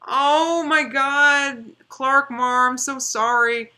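loud, high, drawn-out exclamation; dismayed apology. Real, not acted. Streamer apologizing to a viewer watching from Australia, dismayed.

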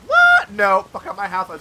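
mock outrage. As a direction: act out an indignant refusal. Acts out refusing the addict.

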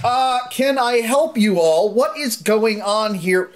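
slow, high flustered teacher. Acted. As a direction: plead like a frazzled teacher. GM voices teacher Mister Mac.

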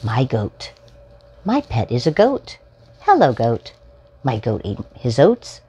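bright, sing-song picture-book reading; reading to children. Acted. Host reading a picture book to young viewers, storytime voice. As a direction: read it out like storytime for little ones.

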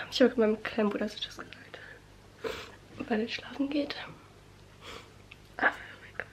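hushed so brother can sleep; whispering. Real, not acted. (flüsternd)

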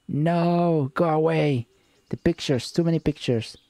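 overwhelmed seer. Acted.

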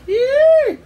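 very high, loud squeal; piercing imitated ape shriek; gorilla shriek. A narrator's reading. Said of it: "she let out a shriek".

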